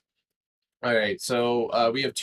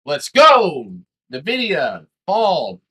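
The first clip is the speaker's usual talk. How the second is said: loud triumphant cheer; excited shout; 11 dB louder, much slower than usual. Real, not acted. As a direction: yell it like a win. Celebrating the falling stock.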